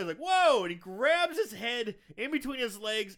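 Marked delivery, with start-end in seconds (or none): 0.2-0.6 high, loud mock alarm; freaked-out yelp. Recapper as Ricky recoiling from coach, loud startled yelp. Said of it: "and Ricky freaks out".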